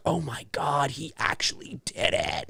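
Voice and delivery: hushed, whispered shock; awed disbelief. Retold or imitated. Viewer retells reacting to the series ending, stunned whisper.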